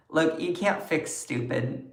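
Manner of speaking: ordinary delivery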